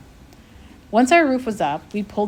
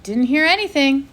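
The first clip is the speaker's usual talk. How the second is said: faking nonchalance; 7 semitones higher, 6 dB louder than usual. A narrator's reading. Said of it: "trying to sound nonchalant, but his voice trembled".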